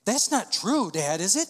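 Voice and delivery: put-on high, loud child's plea; a small boy begging it isn't so; frightened little son. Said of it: "he's like".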